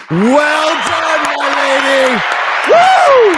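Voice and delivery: high, loud cheer; cheering shout. Acted. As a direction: whoop it up in triumph. Cheering Gina's lawsuit timing.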